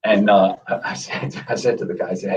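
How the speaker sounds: ordinary talk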